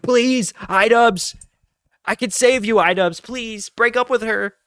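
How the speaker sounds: high, loud, hammed-up begging; mock desperate plea. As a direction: shout it like you're pleading to save him. Begging a podcaster to escape.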